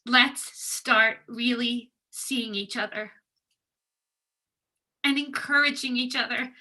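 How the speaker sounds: ordinary talk, nothing marked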